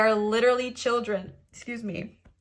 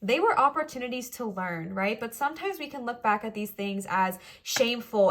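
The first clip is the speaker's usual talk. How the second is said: usual speaking voice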